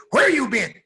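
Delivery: loud, high demanding shout; mother's outcry. Acted. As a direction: cry out at the returning children. Frantic mom confronts returning kids.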